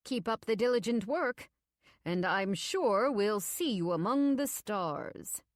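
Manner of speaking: falsely encouraging, measured menace; sinister boss. A narrator's reading. Voices Niles, ominous boss.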